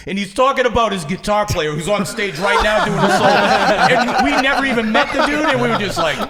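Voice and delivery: cracking up mid-story; real laughter telling the knife story; laughing while telling. Real, not acted.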